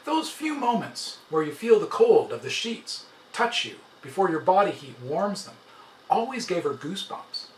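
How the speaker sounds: usual speaking voice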